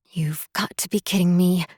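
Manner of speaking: frustrated whisper through gritted teeth; murmured through clenched teeth. Acted. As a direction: hiss it under your breath, teeth clenched. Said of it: "she murmured through clenched teeth".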